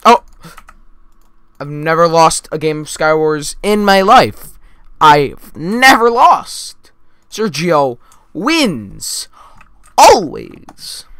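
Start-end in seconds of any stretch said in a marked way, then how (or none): 1.6-11.1 loud, with LOST and WINS punched high and stretched; emphatic boast. Real, not acted. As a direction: declare it slowly, hammering NEVER, WINS and ALWAYS. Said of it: "I'm emphasizing certain words".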